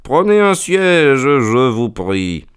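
high, slow, syrupy politeness; honeyed. Acted. Holmes offering the furious doctor a seat, honeyed. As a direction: lay the sweetness on thick, mockingly. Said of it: "dit Holmes d'un ton mielleux".

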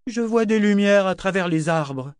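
high-pitched, put-on lordly voice; imperious governor. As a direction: play a demanding governor, pitched well up. Governor's acted voice, ordering her up. (voix haut perchée de gouverneur impérieux)

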